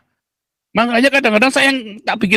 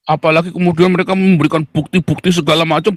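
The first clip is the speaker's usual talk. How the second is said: ordinary delivery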